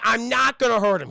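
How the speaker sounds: hammed-up exasperation; irritated denial. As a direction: act out an annoyed husband. Husband retorts loudly.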